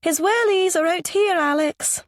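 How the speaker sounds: high-pitched posh Scottish woman calling out; posh Scottish accent. A narrator's reading. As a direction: call from the hallway in refined Scottish. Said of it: "Her accent is Scottish, posh".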